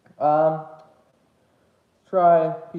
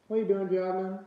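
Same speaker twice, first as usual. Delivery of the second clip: talking to the dog; 4 semitones higher than usual. Real, not acted. Calling to her dog Jasmine.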